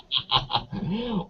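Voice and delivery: short quiet amused laugh; soft laugh. Real, not acted. Guest amused by the Veep example, soft laugh. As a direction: let out a little laugh.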